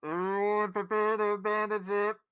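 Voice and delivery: loud, high intercom announcement; muffled static intercom call; shouting abandon ship. Acted. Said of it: "hear the muffled, statically intercom".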